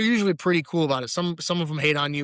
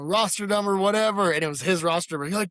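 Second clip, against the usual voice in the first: ordinary delivery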